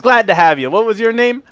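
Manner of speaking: high, eager halfling voice; Pavel. Acted. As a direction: greet a newcomer, pitch climbing on the question. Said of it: "He's very excited to see".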